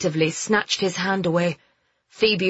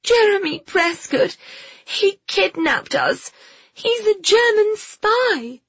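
panting out the warning; out of breath. Acted. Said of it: "out of breath after their ordeal".

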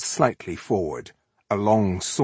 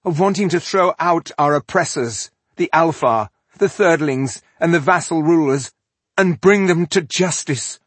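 loud, raised, rallying declaration; defiant proclamation; 8 semitones higher, 6 dB louder than usual. A narrator's reading. Rebel woman proclaiming her cause to the elf, defiant. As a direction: declare the cause proudly, item by item.

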